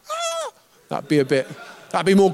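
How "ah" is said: tiny, very high, soft squeak; high-pitched squeal. Shown as a demonstration. Preacher jokes how a demon would not sound, high-pitched squeal. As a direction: squeal it small and comically high. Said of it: "not really high".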